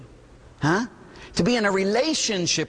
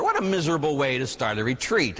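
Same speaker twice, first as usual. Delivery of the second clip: ordinary talk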